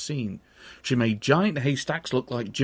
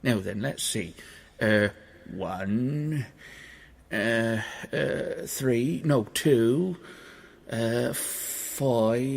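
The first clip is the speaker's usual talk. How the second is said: softer, halting muddled counting; dim giant counting; 2 semitones lower, 4 dB quieter, much slower than usual. Acted.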